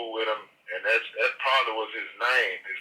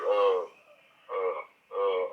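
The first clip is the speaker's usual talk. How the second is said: ordinary talk, nothing marked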